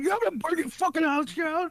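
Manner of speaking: high mock threat; mock angry threat. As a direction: squeak the threat out mockingly. Quoting the angry clip.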